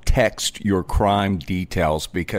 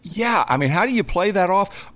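usual speaking voice

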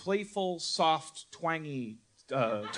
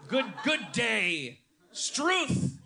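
high, drawn-out Australian exclamations; mock Australian accent; 8 semitones higher, much slower than usual. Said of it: "working on my Australian accent".

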